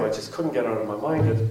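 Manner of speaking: normal talking voice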